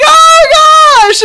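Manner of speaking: loud, high fake shriek; mock agony scream. Acting out the fake severed-finger prank, high loud mock scream. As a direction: wail out in hammed-up shock. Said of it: "rip 'em off and be like".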